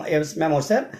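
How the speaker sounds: ordinary talk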